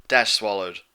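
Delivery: usual speaking voice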